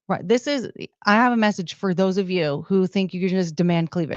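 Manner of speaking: ordinary talk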